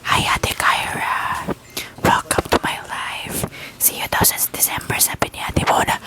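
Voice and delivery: soft breathy ASMR whisper into the mic; hushed ASMR whisper. Shown as a demonstration. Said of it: "A S M R to".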